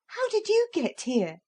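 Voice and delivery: lightly voiced eager girl; young girl. Lucy asks the dogs how they came, eager and high.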